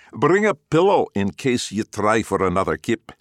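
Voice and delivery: loud teasing Glaswegian dad; Glaswegian accent. Acted. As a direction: rib him in thick Glaswegian. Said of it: "thick Glaswegian accents".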